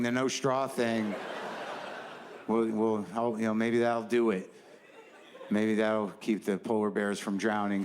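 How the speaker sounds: ordinary talk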